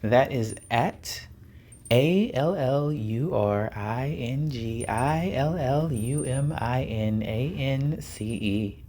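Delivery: slow letter by letter spelling; slow poetic spelling; slow spelled out. Shown as a demonstration. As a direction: spell it out again slowly, savoring it. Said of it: "I'm gonna try that again".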